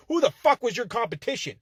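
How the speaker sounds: ordinary talk, nothing marked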